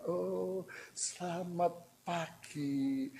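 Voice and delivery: hammed-up coy, soft and drawn-out greeting; mock-dainty, overly sweet slow coo; affected gentleness. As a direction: simper very softly, stretching the words out with little giggles. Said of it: "kita lalu bergaya lemah lembut".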